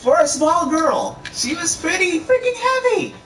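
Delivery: very high, strained-up complaint; squeaky exaggeration. Real, not acted. Vlogger complaining about carrying his girlfriend, squeaky exaggeration.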